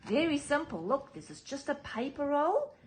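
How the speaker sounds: ordinary delivery, nothing marked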